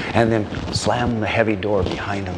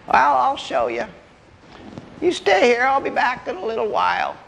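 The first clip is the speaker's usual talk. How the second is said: put-on high-pitched old granddad; old man; 11 semitones higher than usual. Old man promises the hunters a demonstration, high old-timer voice.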